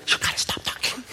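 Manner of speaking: imitated hushed telling-off, all breath; scolding whisper. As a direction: whisper sharply to hush a chatty nurse. Doctor hushing nurses in quiet zone.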